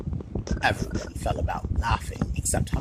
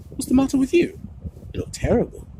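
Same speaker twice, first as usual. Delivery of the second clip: much higher, alarmed question; startled concern; 14 semitones higher, 4 dB louder, faster than usual. A narrator's reading. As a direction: react to a friend's pale face. Ron worried at Harry.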